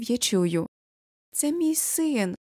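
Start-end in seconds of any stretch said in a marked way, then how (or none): 1.3-2.3 narrated cry held high and stretched; drawn-out cry. A narrator's reading. Mother in the stands claims her son, crying out. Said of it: "кричить Юля".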